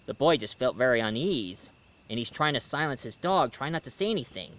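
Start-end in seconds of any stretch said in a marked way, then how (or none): none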